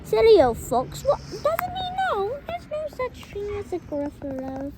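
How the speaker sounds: loud, bold little mouse; little mouse boldly turning down the fox, loud; brave mouse voice. Acted. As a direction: play the plucky mouse, proud and sure. Mouse mocks departed fox.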